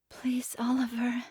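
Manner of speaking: whispered, numb plea; numb begging. Acted. Desi begs Oliver, numb whisper. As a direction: beg with no strength left. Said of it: "I begged numbly".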